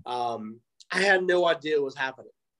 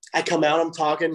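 ordinary talk, nothing marked

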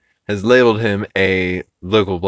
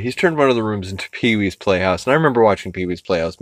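ordinary talk

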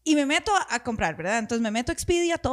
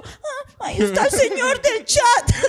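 high, laughing half-cry at the chat man; mock desperate relief; 11 semitones higher, 6 dB louder, slower than usual. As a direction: squeal it with teary laughing relief. Woken by the chat ping.

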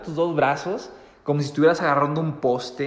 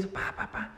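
mock car stops; 6 semitones higher, 13 dB quieter, slower than usual. Imitating cars stopping.

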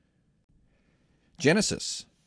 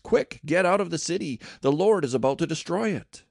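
urgent warning; 6 semitones higher than usual. A narrator's reading. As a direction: warn them in a rush. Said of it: "Lot rushed out to tell".

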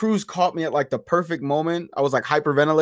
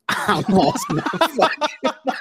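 laughing retelling; 10 semitones higher, slower than usual. Shown as a demonstration. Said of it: "repeats it laughing".

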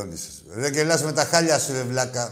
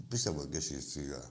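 quiet sarcastic aside; 9 semitones lower, 11 dB quieter than usual. Real, not acted. Sarcastic aside muttered low.

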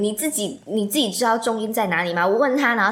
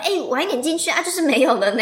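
mock-sweet sarcasm, a bit higher and louder; sarcastic thought; 6 semitones higher, 3 dB louder than usual. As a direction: sneer it in a sugary tone. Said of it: "然后我就想说". (讽刺地想)

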